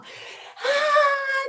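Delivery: high-pitched crying "aah"; mock toddler bawl; imitated tantrum wail. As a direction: wail like a child throwing a fit. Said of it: "começou a fazer uma birra".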